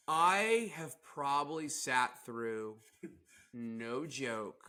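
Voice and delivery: ordinary delivery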